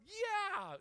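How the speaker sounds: high, loud, gushing yeah; "Yeah." yelped high and eager like a wrong example; overeager yeah. Shown as a demonstration. Preacher showing the wrong overeager answer to a stranger. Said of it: "No, I didn't do it like that".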